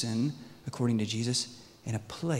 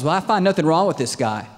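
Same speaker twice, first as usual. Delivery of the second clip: loud, higher-pitched objection; raised-voice insistence; acted protest; 4 semitones higher, 9 dB louder than usual. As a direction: push back at the crowd as Pilate. Pilate protests to accusers.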